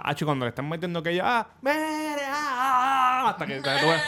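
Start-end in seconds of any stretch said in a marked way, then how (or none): 1.6-3.3 high mock moans, hammed-up; mock moaning. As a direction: fake a woman moaning. Imita gemidos de la mujer. (gemidos fingidos, exagerados)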